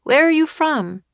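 high, loud call across the yard; boy calling to newcomers. A narrator's reading. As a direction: call it out to strangers. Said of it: "he called".

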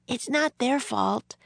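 put-on high, quick little girl; quick girl's voice. A narrator's reading. Girl defends them to the general, quickly. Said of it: "Annie said quickly".

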